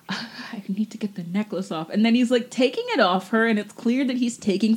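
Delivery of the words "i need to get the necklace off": soft, voiceless mock breakup whisper; whispered imitation. Co-host imitating the breakup, whispered. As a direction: whisper it as the dramatic girlfriend.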